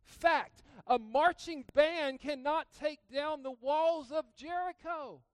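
loud, high preaching declaration; slow, loud proclamation; mock fact declaration. Preaching mock Dwight facts.

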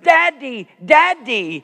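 put-on a child's panicked cry from the crib. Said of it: "a cry of desperation".

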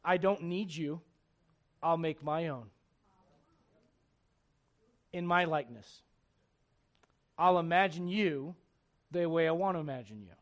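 low, measured self-satisfied declaration; defiant man. Acted. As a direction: voice man coolly dismissing God. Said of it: "Man goes".

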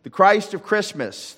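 rousing proclamation. Real, not acted. Closing proclamation.

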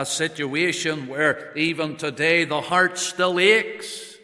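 builds from steady to loud, high pleading; building preaching. Acted. Preacher voicing grieving hearers' cry, rising loud. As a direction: swell up into a ringing appeal.

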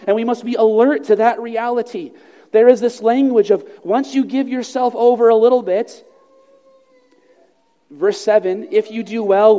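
preacher rousing the congregation to stay alert; fervent preaching. Acted. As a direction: press the warning home with force. Preaching at full force.

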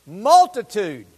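Multitude said slow and loud; emphatic multitude. Shown as a demonstration. Preacher stressing the word multitude with hope, slow and loud. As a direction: lean on Multitude slowly. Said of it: "hear that word multitude".

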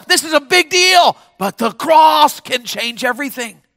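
loud, high, shouted sermon; full-force preaching. Real, not acted. Preacher declaring the cross can change everything, full-force preaching. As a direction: belt out the verses to the crowd.

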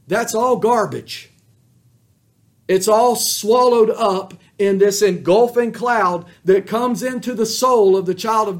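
loud, high preaching voice; fiery sermon delivery; full-force preaching. Acted. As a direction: thunder it from the pulpit.

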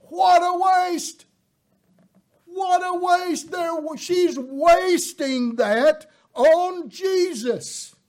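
high, loud scornful cry; indignant shout, slowing at the end; indignant outburst. Retold or imitated. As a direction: spit out the outrage at the waste. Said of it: "They were angry".

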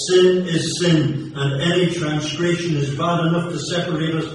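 preacher warning the lost about the devil, fervent and loud; fiery preaching. Acted. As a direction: thunder it at the congregation. Preaching at full force.

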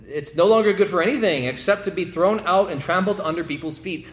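preacher lifting his voice for the verse; preached scripture quote. Acted. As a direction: declaim the verse to the church. Quoting Jesus in sermon.

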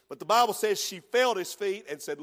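ordinary delivery

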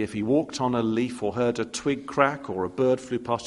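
usual speaking voice

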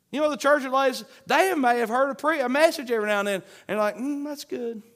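loud, high, driving sermon voice; fiery preaching. Acted. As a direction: preach it hard, urging the crowd. Preaching at full force.

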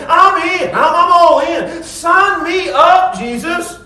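high, loud, slow and emphatic; fired up. Retold or imitated. Scribe telling Jesus he's all in, fired-up shout. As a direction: sign me up with total zeal. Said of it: "he's just so fired up".

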